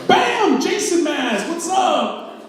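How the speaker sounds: high, loud ambush yell; shouted scare. Retold or imitated. Uncle in Jason mask surprising nephew at window, shouted taunt. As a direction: burst out to startle someone.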